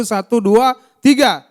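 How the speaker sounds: loud, rising count to cue a crowd; rallying count-in. Real, not acted. Preacher cueing the congregation to shout, loud count-in. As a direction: count them in, punching the last number. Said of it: "Saudara bisa berseru".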